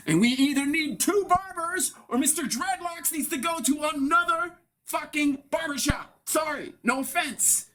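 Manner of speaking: a customer griping about the dreadlocks guy, in a high put-on voice; high rant voice. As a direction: squeak out the complaint. Barbershop rant.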